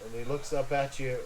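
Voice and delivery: normal talking voice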